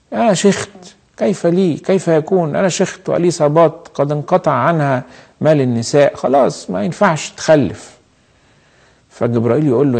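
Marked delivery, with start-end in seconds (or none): 0.1-8.0 high-pitched protest of disbelief; disbelieving Zechariah. Acted. Old priest doubting the angel's promise, incredulous and high. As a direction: object to it as impossible, voice raised in pitch. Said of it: "لما زكريا الكاهن قال له".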